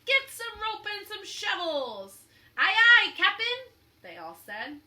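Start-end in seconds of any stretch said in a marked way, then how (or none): 0.0-2.2 slow, loud, higher roar; captain ordering his men. Acted. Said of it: "he roared at his men". 2.6-3.7 very loud, high hearty reply; pirate crew. Acted. Pirate crew answering orders.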